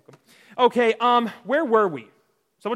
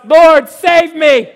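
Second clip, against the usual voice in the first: loud, high, drawn-out cry for help; desperate shout; 8 semitones higher, 13 dB louder, much slower than usual. Acted. Peter, sinking, calls to Jesus, desperate shout. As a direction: yell it out slowly as you sink. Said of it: "and he shouts".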